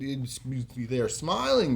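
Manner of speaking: usual speaking voice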